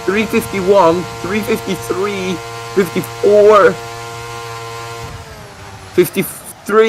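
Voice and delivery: lifted, tense play-by-play; live race commentary. Acted. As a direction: follow the car live, pitch riding up with the speed. Calls the car's speed live.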